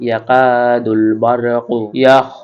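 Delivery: slow, drawn-out chanted syllables; slow recitation. Shown as a demonstration. Teacher reciting slowly for students to repeat. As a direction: stretch each syllable for students to copy. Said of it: "আপনারা আমার সাথে সাথে পড়ুন".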